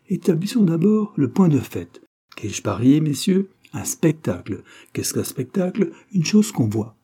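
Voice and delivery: high-pitched mock debating voice; argumentative clerk, declaring. Clerk arguing his bet to colleagues, declaring. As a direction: lay out the case with swooping questions.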